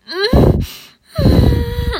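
loud high drawn-out moan; climactic moan. Acted. Woman at the peak of passion, moaning loudly. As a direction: moan at full voice, mouth closed. Acted love scene at its climax.

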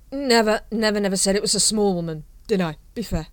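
put-on slurred, loud, rambling drunk captain; drunk Vimes. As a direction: play a tipsy officer waxing on.

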